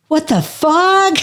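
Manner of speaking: high, slow, stunned exclamation; shocked outburst. Retold or imitated. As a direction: stretch it out in total disbelief. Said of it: "And I went".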